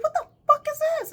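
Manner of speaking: sudden screaming outburst, high and loud; furious shout. Retold or imitated. To herself on the freeway, a high angry shout. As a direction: blow up, yelling at whatever took over. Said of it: "And I just got so angry".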